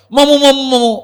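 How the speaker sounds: put-on high, pleading call of a frightened boy; mock pleading nephew. Host imitating scam call voice, high pleading cry. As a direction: imitate a scared nephew crying for his uncle. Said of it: "تو آپ کو بولے گا".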